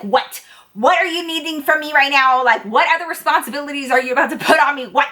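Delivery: high, exasperated retold protest; uptight. Waitress bracing as her boss walks up, uptight. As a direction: demand it, mock-exasperated and on edge. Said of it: "get a little booty tight".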